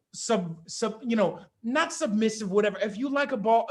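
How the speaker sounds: normal talking voice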